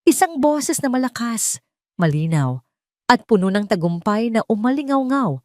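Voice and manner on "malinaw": ordinary delivery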